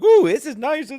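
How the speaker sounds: high, loud cooing start; mock delight. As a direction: coo like a naive pet owner. Mimics naive dog owners.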